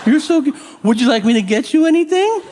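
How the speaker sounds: high, sing-song pet-talk voice, as if to a dog. Retold or imitated. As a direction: coo at an old man like he's a puppy. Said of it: "they're like".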